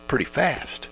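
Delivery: ordinary delivery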